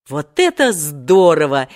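loud, delighted cheer; joint exclamation. A narrator's reading. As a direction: exclaim it like thrilled boys. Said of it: "воскликнули в один голос Волька с Женей".

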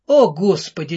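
plain reading voice

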